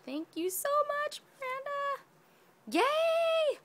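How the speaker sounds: high, squealed thanks ending in a loud yay; delighted squeal. Real, not acted. Thanking Miranda for her gift, delighted squeal. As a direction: gush with a very high, happy cheer.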